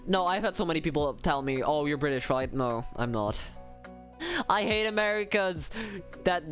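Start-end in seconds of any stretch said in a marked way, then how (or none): none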